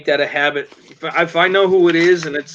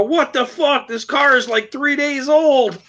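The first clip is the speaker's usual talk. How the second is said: pitched way up, last words dragged out slowly; outraged disbelief; 7 semitones higher, slower than usual. Retold or imitated. Brother just rammed at a stoplight, pitched-up outraged disbelief. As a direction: retell the brother's stunned outrage at being rammed. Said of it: "You hear my brother go".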